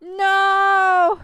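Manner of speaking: long, loud, high wail; high, drawn-out shout of protest; dismayed cry. Real, not acted. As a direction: cry out in dismay, holding the vowel. Cries out at a sad goodbye.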